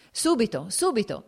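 answering a servant who has come in, briskly; called out. A narrator's reading. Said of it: "disse rivolto al servo".

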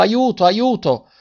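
high, slow frightened cry; boy crying for help beside the old man. A narrator's reading. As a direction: call out desperately for help. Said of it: "un ragazzo che gridava".

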